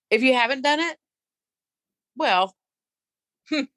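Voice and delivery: ordinary delivery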